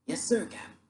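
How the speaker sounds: small, submissive voice; meek answer. Acted. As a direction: answer meekly to the captain. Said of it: "A voice answered meekly".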